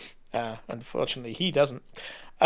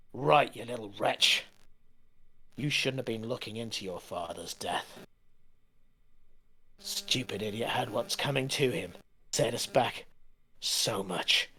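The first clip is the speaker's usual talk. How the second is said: menacing sneer; 4 semitones lower, 7 dB quieter than usual. Acted. Brigand sneering at captive.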